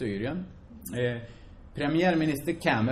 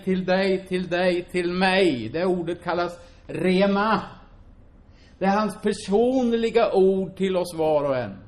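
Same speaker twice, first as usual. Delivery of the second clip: raised, driving preacher's voice; fervent preaching; 5 semitones higher, 5 dB louder than usual. Acted. Preacher urging the congregation, fervent and loud. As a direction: preach it out full to the church.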